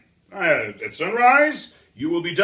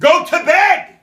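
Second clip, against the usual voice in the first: raised, high command; shouting; 11 semitones higher, 6 dB louder than usual. Acted. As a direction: bark the order loudly. Ordering Maria back to bed.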